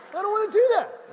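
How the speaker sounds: high, loud whining refusal; mock tantrum whine; childish whine. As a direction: whine like a sulking kid. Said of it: "I'm like".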